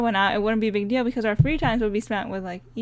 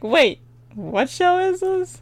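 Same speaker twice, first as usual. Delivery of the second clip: confused listener; 9 semitones higher, slower than usual. Retold or imitated.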